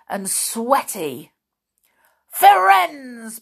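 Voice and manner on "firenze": loud, high furious shout of a name; thundering call. A narrator's reading. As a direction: roar his name across the clearing. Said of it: "Bane thundered".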